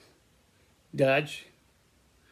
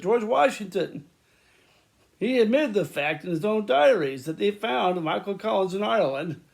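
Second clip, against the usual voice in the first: excited; 6 semitones higher, 4 dB louder than usual. Real, not acted. Excited history rant.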